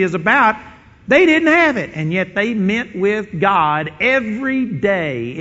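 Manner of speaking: loud, driving sermon delivery, pushing each line; preaching at full force. Acted. Preacher driving home a point to the congregation, loud and fervent. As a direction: hammer it home like a revival preacher.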